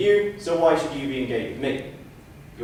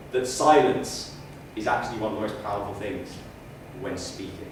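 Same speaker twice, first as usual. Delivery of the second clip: slow with pauses; 7 semitones lower, 4 dB quieter than usual. Shown as a demonstration. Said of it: "the best way to emphasize it is to be silent".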